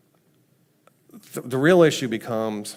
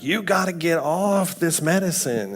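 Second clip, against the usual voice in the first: higher-pitched, lightly acted insistence; urging; 7 semitones higher than usual. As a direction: imitate someone pushing firm advice. Quoting Daniel's urging.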